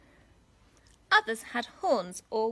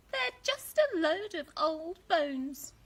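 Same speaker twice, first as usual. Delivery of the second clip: grandma voice; 7 semitones higher, slower than usual. Acted.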